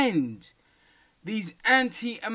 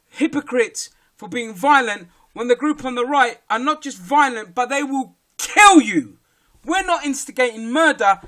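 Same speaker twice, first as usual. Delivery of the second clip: angry rant; 5 semitones higher, 7 dB louder than usual. Real, not acted. Ranting at right-wing critics.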